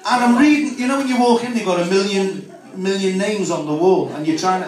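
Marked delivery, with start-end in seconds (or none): none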